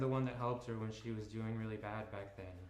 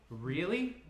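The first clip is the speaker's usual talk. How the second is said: high, louder, hurt rising question; angry disbelief; 7 semitones higher, 7 dB louder than usual. Acted. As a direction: repeat it, still stung. Ellie angry on the phone.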